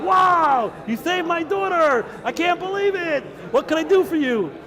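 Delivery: a stunned emperor overflowing with thanks; amazed gratitude. Acted. Caesar thanks Reb Shimon, high amazed delight.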